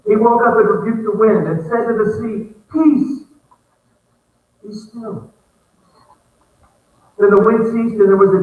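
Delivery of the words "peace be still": mildly exasperated command, pause after the first word; frustrated rebuke. Acted. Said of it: "you can hear the frustration in his voice".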